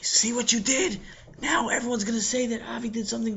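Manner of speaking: hushed whisper. Acted. Yossi to Sammy, whispering. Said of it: "whispered to Sammy".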